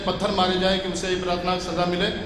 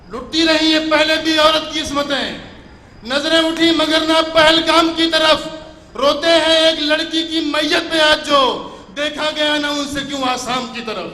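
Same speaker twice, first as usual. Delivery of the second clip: louder declaiming; stately nazm recitation; 9 semitones higher, 8 dB louder than usual. Acted. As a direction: build it up louder. Louder repeat. (بلند آواز میں نظم خوانی)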